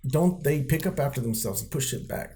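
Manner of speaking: mild mock outrage at a messy floor; exasperated rant. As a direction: throw up your hands at the mess, half-mocking. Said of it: "I was like".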